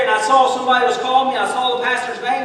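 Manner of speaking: ordinary talk, nothing marked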